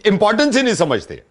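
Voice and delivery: put-on exasperation. Prank uncle lecturing his friend's son on history, exasperated.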